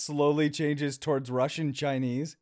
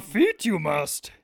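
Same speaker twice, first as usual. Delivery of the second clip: Indian Yoda voice; slower than usual. Pretend. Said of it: "Indian Yoda".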